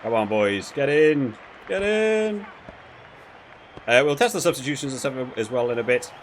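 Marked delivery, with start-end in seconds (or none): none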